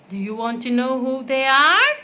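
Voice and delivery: plain reading voice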